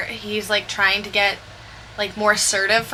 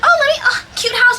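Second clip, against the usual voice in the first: much higher, louder gushing squeal; mock excited gush; 13 semitones higher, 5 dB louder than usual. Reading comments at dawn, mock excited squeal. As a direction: gush over a cute house.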